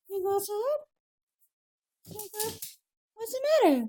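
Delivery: very high, sing-out puppy greeting; puppy talk. Real, not acted.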